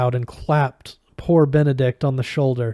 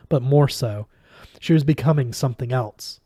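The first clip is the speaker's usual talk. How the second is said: ordinary delivery, nothing marked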